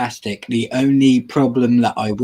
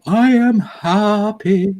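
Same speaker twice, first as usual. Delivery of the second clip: mock cheerful; 8 semitones higher, much slower than usual.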